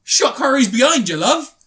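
high, loud mock horror; dramatic shock. Hamming up the attacker grabbing the girl, loud. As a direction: blurt it out like a jump scare. Sudden attack in film retelling.

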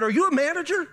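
high-pitched, indignant question; incredulous challenge. Retold or imitated. Fired-up customer questioning the agent's authority, incredulous.

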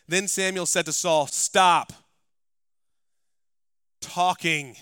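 impassioned pulpit delivery; full-force preaching. Acted. Preaching, Samuel's rebuke.

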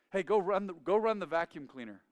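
hammed-up dad calling out a chore; Dad's quirky order. Dad sends his lazy son to vacuum, loud and upbeat. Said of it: "That was the way he said it".

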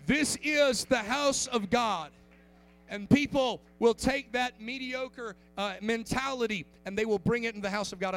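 loud, slow, weighty preacher's declaration; preaching. Acted. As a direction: lean on every word like a pulpit pound. Preaching at full force.

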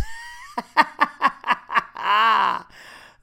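loud high cackle; big high laugh. Real, not acted. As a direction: let the laugh burst out. Cracking up after reading.

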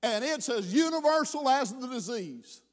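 ordinary talk

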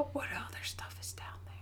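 awed hushed whisper; a thrilled whisper about what lies below. Real, not acted. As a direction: breathe it out in a secret whisper. Gasps at the lake's secrets.

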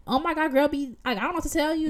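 high, emphatic mock warning; imitated insistent stranger.

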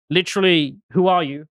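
ordinary talk, nothing marked

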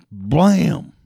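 loud shouted impact sound; blam strike. Retold or imitated. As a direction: bark out a cartoon strike noise. Snake strikes owner.

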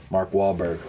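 ordinary delivery

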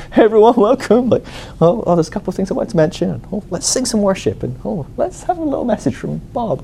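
high, chirpy mock welcome voice; mock church host. Mocking a home-group leader welcoming everyone, high and chirpy.

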